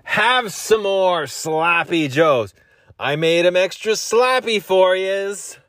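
high, slow character voice; drawn-out hammed-up host offering food; put-on voice. Home Alone style caricature voice.

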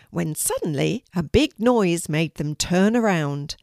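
ordinary delivery

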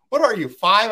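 mocking wife. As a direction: tease him like a child. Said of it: "And she's like".

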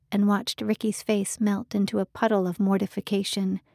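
usual speaking voice

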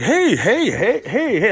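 high, sing-song hellos; hammed-up chirpy greeting; eager greetings. Greeting one person after another.

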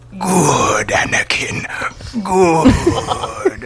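hissed, sinister praise; menacing evil villain. Retold or imitated. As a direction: savour each word like a villain. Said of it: "his most evil voice ever".

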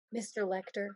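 low, hushed Southern drawl; soft Southern drawl. Retold or imitated. Podcaster practising the trainee's line, quiet Southern drawl. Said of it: "the only thing we can say with the drawl".

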